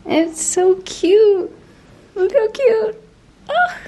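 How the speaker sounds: very high, slow squeal; gushing cute. Real, not acted. Gushing over the bed loft.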